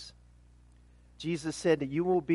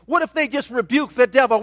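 ordinary talk

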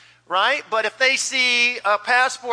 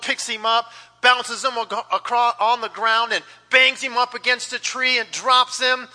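usual speaking voice

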